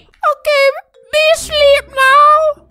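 put-on baby voice. Baby obeys mom in roleplay.

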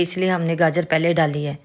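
ordinary delivery

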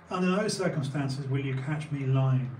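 usual speaking voice

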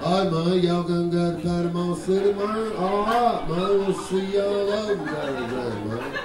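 Florida Panhandle drawl; slow Southern rocker accent from the Panhandle; lazy, low Panhandle Southern accent; [name]. Acted. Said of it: "you get the [name] [name] accent".

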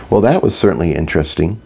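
ordinary delivery, nothing marked

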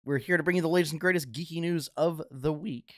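podcast announcer voice, lifted and loud; upbeat show intro. Acted. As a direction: open the show like a radio host greeting listeners. Podcast host opening over music.

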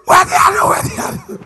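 very high, loud strained cry; dazed cry. Acted. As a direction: wail like your head still rings. Dazed cry after slap.

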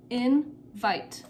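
"Invite" broken in two, IN, then VITE; split into syllables. Shown as a demonstration. Teacher showing pupils the two syllables, split "Invite" apart. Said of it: "two syllables".

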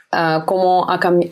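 ordinary talk

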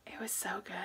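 soft whispered praise; hushed, amazed whisper; awed whisper. Real, not acted. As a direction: whisper it, still stunned. Awed reaction after routine.

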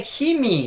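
"Jimmy" with an H sound; variant pronunciation. Shown as a demonstration. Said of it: "algunos dicen".

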